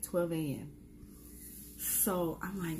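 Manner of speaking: ordinary talk, nothing marked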